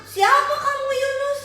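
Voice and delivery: high, loud questioning; demanding sailors. Acted. Sailors demanding who Yunus is.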